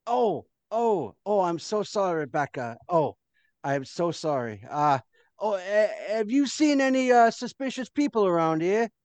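a hint of a flustered guard, apologetic; backpedal, embarrassed. Guard apologizes to the maid, flustered.

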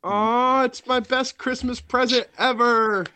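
high, slow, syrupy sarcasm; sarcastic gushing. Acted. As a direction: gush like a child at Christmas. Sarcastic gushing over Sean.

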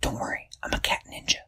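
whispered, quick ninja boast; hushed cat ninja whisper; stealthy whisper. Acted. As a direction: whisper it like sneaking up.

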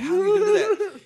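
high laughing question. Real, not acted. Laughing at the memory.

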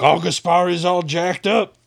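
high, drawn-out mock cheer; imitated hyped-up soldiers. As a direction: whoop it up slowly like an amazed crowd. Imitating hyped-up troops' reaction.

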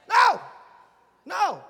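loud high no; firm loud refusal; emphatic shout. Real, not acted. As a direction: shout it down hard. Preacher rejecting the life of don'ts.

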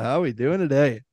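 ordinary delivery

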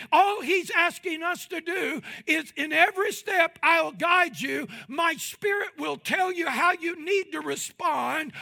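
high, loud call to follow; loud voice of God. Acted. God calling everybody to come his way, loud shout.

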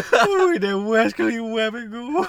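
high, slow, laughing cartoon voice; heavy gas voice. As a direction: laugh through a cartoon hunter line. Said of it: "my voice is so deep when I'm doing this".

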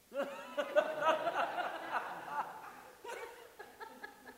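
long, high laugh that falls away; high burst of laughter. Real, not acted. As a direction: let a real laugh ring out high. Laughs at own retold cheer.

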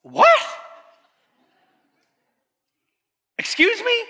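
high, stunned "Excuse me?"; the dad can't believe what he heard; shocked dad. Retold or imitated.

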